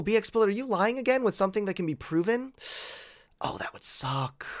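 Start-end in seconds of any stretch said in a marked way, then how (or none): 3.4-4.5 breathy whispered aside. Real, not acted. Streamer reading accusations, whispering to himself. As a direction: mutter it under the breath, dreading it.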